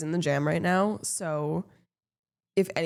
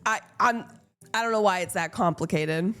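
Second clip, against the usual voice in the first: ordinary talk, nothing marked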